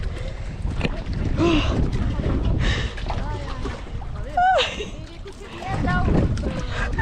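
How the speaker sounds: sharp high gasp at icy water; gasp from cold. Real, not acted. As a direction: gasp hard from the cold shock.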